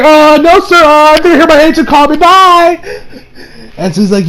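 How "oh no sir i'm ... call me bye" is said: much higher, louder frantic squeal; panicky excuse. Retold or imitated. Barry fleeing the scary director, high panicky excuse.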